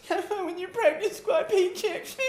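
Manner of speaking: high, laughing mock gloat; laughing taunt. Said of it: "have you go".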